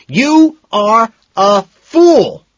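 very loud, high, drawn-out bellow; a slow, furious bellow. A narrator's reading. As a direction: roar each word at her. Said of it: "I bellow".

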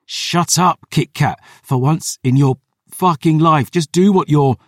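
desperate scolding. Acted. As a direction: snap urgently at a stubborn patient. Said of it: "My voice faded".

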